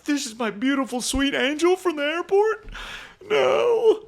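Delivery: high-pitched, hammed-up dismay; mock horror. Retelling finding a crush's porn online, in mock horror. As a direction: wail it high in comic disbelief. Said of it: "watching it in horror".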